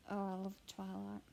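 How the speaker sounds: ordinary talk, nothing marked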